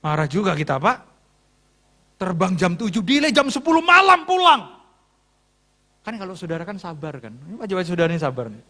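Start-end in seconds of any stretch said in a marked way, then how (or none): 3.0-4.8 higher, louder outburst; exasperated complaint. Real, not acted. Pastor griping to congregation about late flight, exasperated. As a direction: raise voice in protest at the delay.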